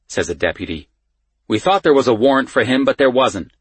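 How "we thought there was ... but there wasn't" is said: quick, higher, louder excuse; defensive explanation. A narrator's reading. Deputy defends the mix-up.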